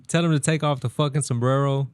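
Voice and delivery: normal talking voice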